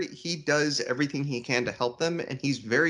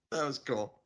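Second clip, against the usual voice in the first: ordinary talk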